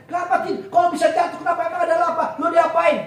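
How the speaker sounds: loud, high, rapid-fire demands to know; acted-out uproar of worried mates; agitated questioning. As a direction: fire off questions like alarmed friends. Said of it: "Mereka bertanya seperti ini".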